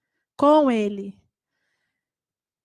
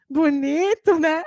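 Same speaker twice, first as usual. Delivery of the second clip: mocking bonito, né, pushed louder; louder mocking echo; 4 semitones higher, 4 dB louder, slower than usual. Children mock parents' automatic reply again, louder teasing. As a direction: rub it in harder.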